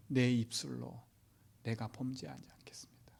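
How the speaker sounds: hushed, solemn promise; whispered vow. Acted. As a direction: vow it under your breath. Job's vow quoted softly.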